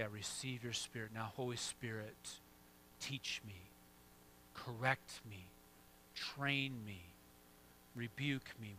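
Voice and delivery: very soft, intimate prayer, each request set apart; hushed prayer. Shown as a demonstration. Pastor demonstrates a breath prayer to the church, hushed. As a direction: murmur it to God with long pauses. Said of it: "and say".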